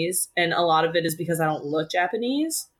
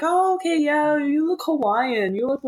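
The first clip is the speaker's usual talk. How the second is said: much higher, slow mock stranger voice; clueless stranger; 9 semitones higher than usual. Guest mimicking people who say she looks Hawaiian, high and slow. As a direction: lampoon a stranger guessing her ethnicity. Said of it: "people would be like".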